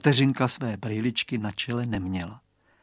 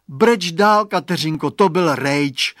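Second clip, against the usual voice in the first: a hint of disgust, raised; fed-up boy's higher, louder call; 4 semitones higher, 8 dB louder than usual. A narrator's reading. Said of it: "řekl znechuceně Radovan".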